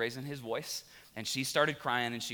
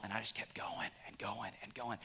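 very soft, near-whispered and lower; hushed confession; 4 semitones lower, 13 dB quieter than usual. Real, not acted. Preacher confessing he kept yelling at family, hushed. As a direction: let the repeats fade into a whisper.

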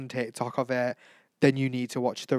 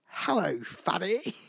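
mock bald man; 6 semitones higher, slower than usual. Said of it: "and said".

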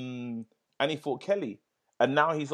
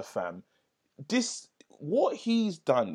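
ordinary talk, nothing marked

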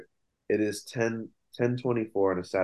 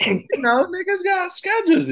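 playful; 7 dB louder than usual. Real, not acted. Joking excuse for break.

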